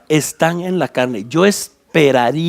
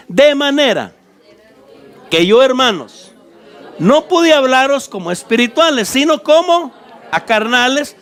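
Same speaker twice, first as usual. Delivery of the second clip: raised, preacherly reading of the verses; scripture reading; 5 semitones higher than usual. Acted. Preacher reading Paul's letter to the church, raised proclaiming voice. Preacher reads Corinthians aloud.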